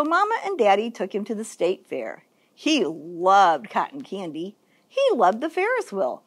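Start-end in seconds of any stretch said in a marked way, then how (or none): none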